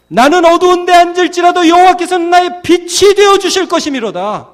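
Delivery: high, loud proclamation; confession of faith. Acted. Believer trusting God as light in darkness, proclaiming. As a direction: declaim it on a sustained high pitch. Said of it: "이렇게 믿음으로 고백하는".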